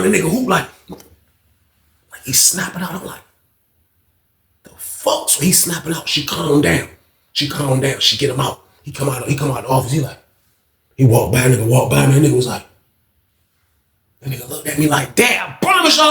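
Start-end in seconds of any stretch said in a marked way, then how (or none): 4.6-5.3 whisper. Retold or imitated. Shocked reaction while watching. 15.2-16.1 retold yell. Inmate yelling at narrator.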